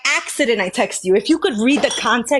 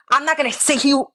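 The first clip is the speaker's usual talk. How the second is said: ordinary talk, nothing marked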